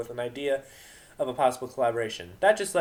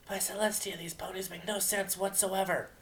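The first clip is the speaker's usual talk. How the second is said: 1 lightly voiced Octavia; 7 semitones higher, 4 dB quieter than usual.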